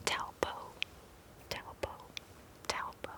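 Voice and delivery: breathy Taupo, Taupo, Taupo; repeated whispered trigger word. Shown as a demonstration. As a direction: whisper Taupo three times softly. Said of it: "repeated trigger word".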